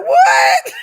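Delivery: loud, very high outraged shriek; mock outrage. Acting out someone hearing gossip about them, mock-outraged shriek. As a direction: shriek it in exaggerated disbelief.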